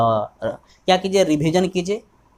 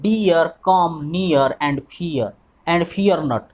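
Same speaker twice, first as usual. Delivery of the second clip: slow dictation; much slower than usual. Shown as a demonstration. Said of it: "तो इसमें क्या हुआ है".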